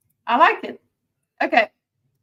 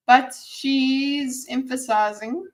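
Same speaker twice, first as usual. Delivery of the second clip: drawn out mock; much slower than usual. Shown as a demonstration. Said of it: "mocking the stammer".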